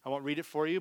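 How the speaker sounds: usual speaking voice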